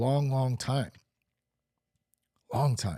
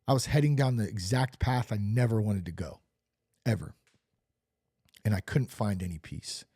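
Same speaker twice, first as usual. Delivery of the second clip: ordinary talk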